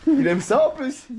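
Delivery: high laughing mock scolding. Real, not acted. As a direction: scold him while laughing. (faux reproche en riant)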